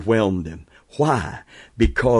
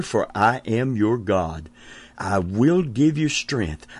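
ordinary talk, nothing marked

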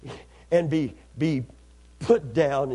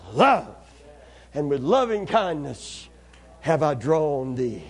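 hushed voice of God's love; tender promise; 3 semitones lower than usual. Acted. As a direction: speak it like a gentle vow.

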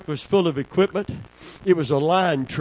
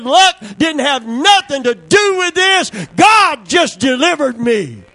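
much higher, louder shouted testimony; hollering; 9 semitones higher, 6 dB louder than usual. Real, not acted. Preacher hollering back at the crowd, shouting. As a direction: holler it with joy. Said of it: "I hollered like I'm hollering now".

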